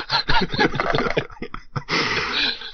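helpless high burst of laughter; laughing. Real, not acted. Host cracks up reading a fan's rude email, laughing. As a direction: crack up mid-read.

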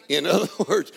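ordinary delivery

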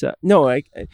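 normal talking voice

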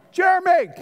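called out high and loud; loud call of a name. Real, not acted. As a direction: shout his name across the room. Calling out to Jeremy.

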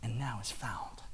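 slow whisper of the last words; whispered close of the parable; hushed reading. Acted. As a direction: breathe the ending out, slow and soft. Reads the story's hushed ending.

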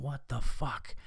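under-the-breath retold grumble; muted exasperation. Dog owner reacting to a barking dog, quietly exasperated. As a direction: grumble it softly to yourself.

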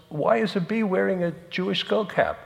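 high, puzzled joke character; puzzled fly. Acted. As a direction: ask it high, setting up the punchline. Fly puzzled, sets up punchline.

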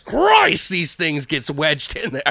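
high, slow cartoonish Santa grumble; killer Santa. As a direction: strain at the stuck axe, then call after him. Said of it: "And he's like".

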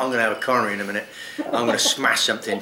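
fast rushed exasperation; exasperated rush. Retold or imitated. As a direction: race through it in frustration. Retold fuming thoughts.

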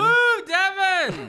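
loud high whooping cheer; cheering shout. Pretend. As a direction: yell it like a fan in the crowd. Mock cheering for rival.

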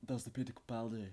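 put-on soft Scottish accent; quietly put-on Scottish; Peter Capaldi. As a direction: lightly imitate a Scottish actor. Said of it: "Pierre Capaldi".

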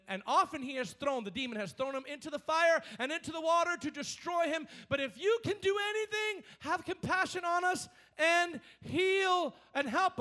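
high, loud pleading read of the father's words; fervent scripture reading. Acted. Preacher voicing the father's plea to Jesus, high and loud. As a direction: plead the verse out to the crowd.

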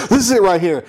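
high, eager showing-off; mock-thrilled presentation; excited lady. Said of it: "she gets all fucking excited too".

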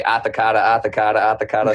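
put-on "Arthur Carter" in a Boston accent, r's dropped; "Arthur Carter" rattled off Boston-style without r's. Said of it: "that my accent".

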